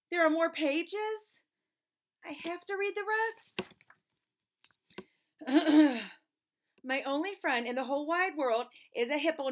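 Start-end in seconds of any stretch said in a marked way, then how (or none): 0.1-6.0 mock dismay. Exasperated reader.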